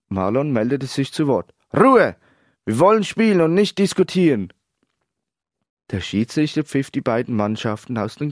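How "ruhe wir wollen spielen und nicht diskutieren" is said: loud, high call for silence; exasperated, forceful command; shouted order. A narrator's reading. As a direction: cut the discussion off with a shout. Coach silences the changing room.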